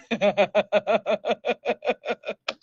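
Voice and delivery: staccato, pulsing laugh; long laugh. Real, not acted. Host welcoming viewers to the show, laughing at length. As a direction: let out a long run of even ha-ha bursts.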